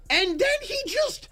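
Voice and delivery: high-pitched, drawn-out disbelief; incredulous. Real, not acted. Storyteller revealing the worker walked out, incredulous. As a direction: stretch it out, stunned and high.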